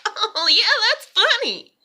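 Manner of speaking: high, loud fake laughing approval; mock amusement. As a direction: squeal it sarcastically, as if tickled. Mocking an imagined amused parent's reaction.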